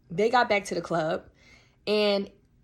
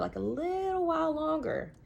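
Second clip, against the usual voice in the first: high, slow, stretched-out plea; drawn-out pleading; 9 semitones higher, 3 dB quieter than usual. Retold or imitated. Coaxing friends to stay longer, high and slow.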